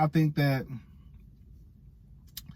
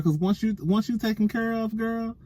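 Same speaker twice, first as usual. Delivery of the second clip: ordinary talk, nothing marked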